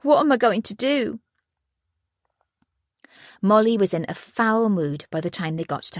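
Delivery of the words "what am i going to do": high, breaking cry of despair; tearful. A narrator's reading. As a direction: plead through fresh tears. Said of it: "She burst into tears".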